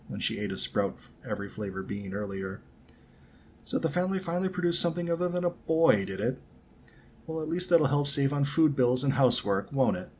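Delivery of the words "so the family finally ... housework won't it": higher-pitched, idle sneer at a rival; lazily needling, lifted in pitch; lazy taunt. A narrator's reading. Malfoy taunts Ronnie about her family, lazy taunt. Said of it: "Malfoy said lazily".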